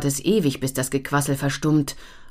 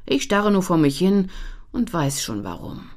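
plain reading voice

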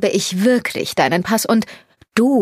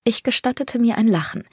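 ordinary delivery, nothing marked

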